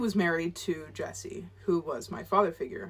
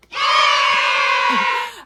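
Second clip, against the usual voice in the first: shrieking laugh, much higher and louder; 10 dB louder than usual. Real, not acted. Vlogger celebrating graduating college, whooping laugh.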